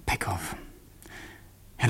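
ordinary delivery

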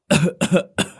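sharp, pained coughing fit; wounded cough. Acted. Injured fortune teller coughing mid-sentence, sharp and pained. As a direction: cough as if blood is in the throat. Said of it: "Ông ấy ho lên mấy tiếng".

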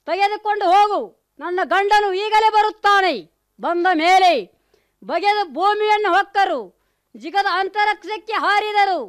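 loud, furious warning; angry threat. Acted. Hidimbi threatening the demon, loud and furious. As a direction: shout him away in anger.

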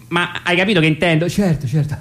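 words broken by high laughter; real amused laughter; laughing. Real, not acted. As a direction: say it through giggles. Still laughing at joke.

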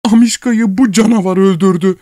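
high tearful outcry; wife crying out. A narrator's reading. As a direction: wail the accusation. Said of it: "diye haykırdı". (ağlamaklı yüksek haykırış)

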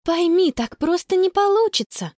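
high, pleading explanation; trying to explain. A narrator's reading. Sister explaining the crowded tent to brother, pleading. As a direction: reason with an upset child. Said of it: "попыталась она объяснить".

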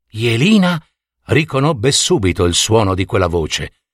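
ordinary delivery

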